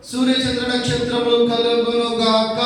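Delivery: high, slow, grand proclamation; booming voice of God. A narrator's reading. Teacher voicing God for children, slow and grand. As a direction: proclaim it like God creating the sky. God's command in creation story.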